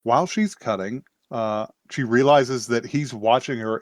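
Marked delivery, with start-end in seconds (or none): none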